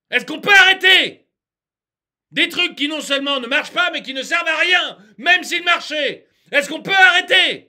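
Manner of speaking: high, loud, exasperated pleading; worked up. Real, not acted. Ranter demands an end to absurd rules, exasperated shouting. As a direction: shout each question in despairing outrage. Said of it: "je m'énerve".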